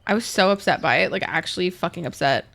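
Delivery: ordinary delivery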